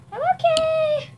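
high squeaky slime voice; slime character voice. Pretend. Kid voicing her slime toy, squeaky high voice.

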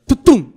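sudden jolting thud noise; loud conveyor thud. Retold or imitated. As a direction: bang it out like the machine starting. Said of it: "eu fiz tipo, tutum!".

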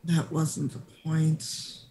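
slow, resigned in-character reply; weary exasperation. Acted. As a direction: draw it out, tired of their nonsense. Harold exasperated with silly party.

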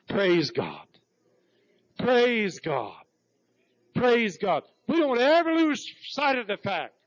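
preacher lifting the room; rising praise. Real, not acted. Preacher exulting after testimony.

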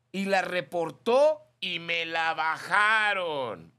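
high, loud squeaky put-on voice, slowed down; mock Klaus voice. Radio host reading Klaus's message in a silly high voice. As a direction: ham up the listener's gossip in a silly high voice. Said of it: "Dice Klaus".

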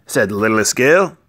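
plain reading voice

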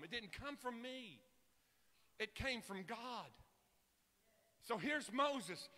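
ordinary delivery

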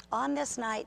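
ordinary talk

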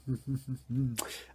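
closed-mouth "hm-hm-hm" chuckle; teasing chuckle. Real, not acted. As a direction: chuckle knowingly after the teaser. Teasing chuckle after announcing next episode.

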